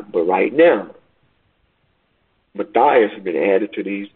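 ordinary talk, nothing marked

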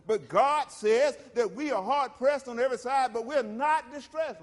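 preacher swelling from steady to full force; building preacher cadence. Acted. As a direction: build it up to a shouting climax.

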